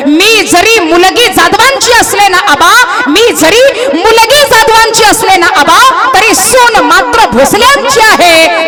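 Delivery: high, slow, ringing declaration; proud declaration. A narrator's reading. Daughter refuses father out of loyalty to in-laws, high ringing pride. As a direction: declare it with deliberate, mounting force.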